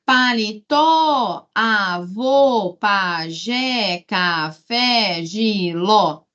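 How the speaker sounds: paleTÓÓ, aVÔÔ, paJÉÉ, caFÉÉ, giLÓÓ, last syllables held; calling the words. Shown as a demonstration. Teacher showing oxytones, calling out each word. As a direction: chant the list, stretching each final syllable. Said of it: "chama a palavra".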